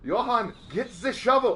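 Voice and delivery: put-on loud, high German-accented order; mock German accent. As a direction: call out in a hammed-up German accent.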